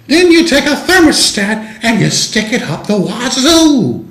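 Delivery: put-on very high, slow, warbling Julia Child voice; Julia Child impression. Host channeling a TV chef, high sing-song Julia Child voice. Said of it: "you remember Julia Childs".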